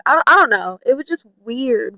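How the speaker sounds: ordinary talk, nothing marked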